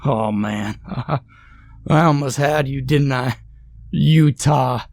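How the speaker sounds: high, laughing through the words; cracking up at his own joke; laughing hard. A narrator's reading. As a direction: Utah said through big laughs. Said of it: "busted out laughing again, harder this time".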